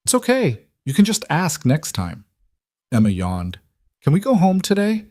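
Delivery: put-on high, sleepy little girl; a tired child asking to go home. A narrator's reading. Sleepy child talks to mother.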